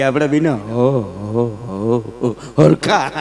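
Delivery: stuck on "હો" before "હરખા"; comic stutter; stammering. Retold or imitated. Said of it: "તોતડી જીભ".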